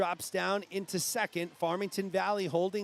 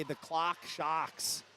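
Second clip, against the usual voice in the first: R-less New England accent, drawn out slowly; 3 semitones lower, 4 dB quieter, slower than usual. Shown as a demonstration. Commentator showing listeners their usual way, New England accent. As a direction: drop the R's like a thick New Englander. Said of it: "my bad New England accent".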